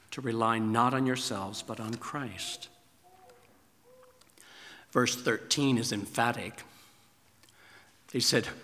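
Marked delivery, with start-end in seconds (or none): none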